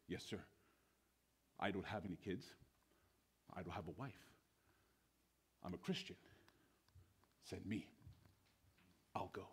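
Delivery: low, soft, spaced-out statements; hushed, humble resolve; calm volunteer. Acted. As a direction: offer your life quietly, one short phrase at a time. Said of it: "one gentleman steps forward".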